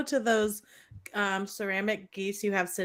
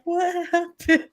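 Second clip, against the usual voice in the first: much higher and slow, lightly voiced worried friend; concerned friend; 10 semitones higher, slower than usual. Ashley asking her crying friend, slow concerned higher voice. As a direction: ask gently like a caring friend. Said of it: "and she's like".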